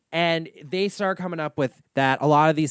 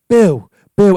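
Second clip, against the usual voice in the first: loud hammed-up ghost boo; jokingly spook the room; mock spooky ghost; 5 semitones higher, 11 dB louder than usual. Retelling the show's actress, a hammed-up loud boo.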